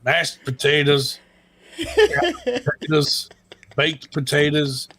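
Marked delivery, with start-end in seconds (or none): 1.8-3.3 silly stretched word. Acted. Silly potato riffing.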